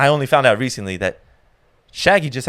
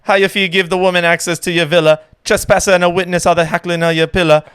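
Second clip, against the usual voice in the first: rapping [name]'s verse loud, without music; karaoke [name]; 5 semitones higher, 6 dB louder than usual. Pretend. Guest performs [name]'s verse a cappella for the host, loud chant. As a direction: belt the verse like karaoke night, a touch of [name]. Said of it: "This is how I sound when I do karaoke".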